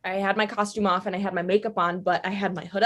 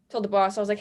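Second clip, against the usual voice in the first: ordinary delivery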